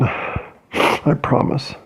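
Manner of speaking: slow, whispered through tears; a soft promise while crying; tearful whisper. Real, not acted. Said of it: "I can't even compose myself".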